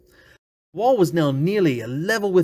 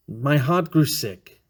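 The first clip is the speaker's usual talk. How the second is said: ordinary delivery, nothing marked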